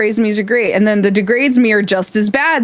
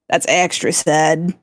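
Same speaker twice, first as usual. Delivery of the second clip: deadpan insult; 5 semitones lower, slower than usual. Pretend. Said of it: "Degrade me".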